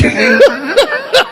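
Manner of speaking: high hearty laugh breaking out; big laugh. Real, not acted. Laughing at his own story to the crowd.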